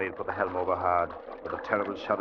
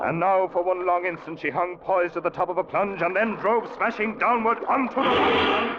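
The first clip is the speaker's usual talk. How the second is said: dramatic narration building; 10 semitones higher than usual. A narrator's reading. Narrator telling how the ship plunged, rising to the crash. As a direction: build to a climax.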